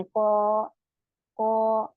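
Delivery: कौ held for two counts, drawn out; elongated kaa sound; stretched long vowel. Shown as a demonstration. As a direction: draw कौ out long and steady. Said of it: "इसको हम ऐसे ही पढ़ेंगे".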